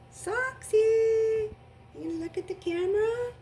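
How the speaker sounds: high coaxing pet voice; talking to the dog. Real, not acted. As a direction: coax the dog to look up. Coaxing her dog on camera.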